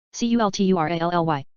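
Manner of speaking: letters rattled off fast; quick spelling. Shown as a demonstration. Teacher spelling the word fast for the class. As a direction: run through the letters briskly. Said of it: "spelling drill".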